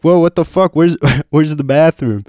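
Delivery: loud, high, flustered protest; retold startled protest. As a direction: act out bewildered objection. Retold protest while being pushed.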